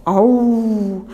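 loud roaring tiger howl; a big imitated tiger roar. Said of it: "就听见一阵吼".

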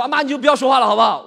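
fast, loud, exasperated; impatient plea. Acted. Cutting his mother off, fast, loud and impatient. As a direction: rush it, fed up with mom.

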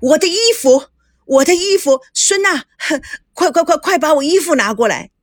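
yelling for his clothes in a panic; 慌张大喊; frantic shout. A narrator's reading. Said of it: "赵熙在厕所里中大叫".

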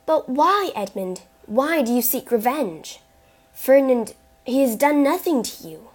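impassioned protest; anguished plea. A narrator's reading. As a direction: plead urgently, voice lifted and pressing. Mercedes pleads with the Count.

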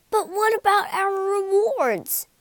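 high, loud chorus of voices; friends asking together. Acted. Friends ask the wizard together for rewards, high pleading chorus. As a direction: plead as one group. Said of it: "asked the friends together".

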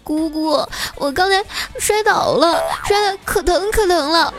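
put-on high little-girl whine, drawn out at the end; mock crying child. Little niece telling aunt she fell, tearful whine. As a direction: whimper like a toddler who fell, hammed-up. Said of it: "哭着对我说".

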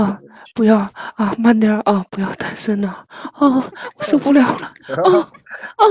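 put-on pleading moans between short phrases; hammed-up breathless pleading; mock moaning. Streamer doing a forfeit for co-hosts, mock moaning.

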